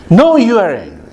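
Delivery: high, emphatic imitated retort; retorting son. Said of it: "my son says, "Why you are angry?".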